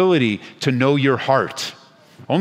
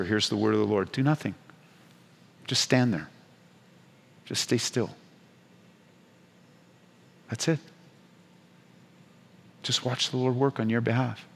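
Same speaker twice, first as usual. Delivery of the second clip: soft closing word; 7 dB quieter than usual. Real, not acted. Quiet closing word of comfort.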